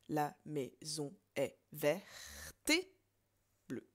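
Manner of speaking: very slow, each syllable separated; slow syllable count. Shown as a demonstration. Teacher giving the corrected count slowly to students. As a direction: count it out beat by beat. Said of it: "ça ne peut pas compter".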